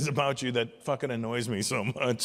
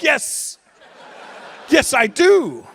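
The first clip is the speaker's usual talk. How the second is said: high, loud, laughing agreement; eager yes; 11 semitones higher, 9 dB louder, much slower than usual. Retold or imitated. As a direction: gush yes like a delighted old-timer. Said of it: "And I'm like".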